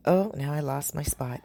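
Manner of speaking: ordinary delivery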